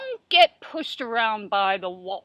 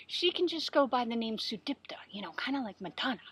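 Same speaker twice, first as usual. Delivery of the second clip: quick, hushed joking whisper; whispered aside; 2 semitones lower, 10 dB quieter, faster than usual. Acted. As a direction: lean in and whisper the joke fast. Joking aside about author's name.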